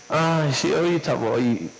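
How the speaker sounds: low, drowsy put-off; mumbly imitation of someone woken from sleep; sleepy reluctance. Preacher acts out being woken while warm in bed, sleepily reluctant. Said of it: "y te despiertan".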